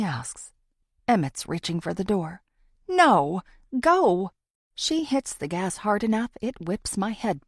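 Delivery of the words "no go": loud, urgent "go"; urgent shout. A narrator's reading. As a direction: yell at the driver to drive off. Urgent escape from stalker.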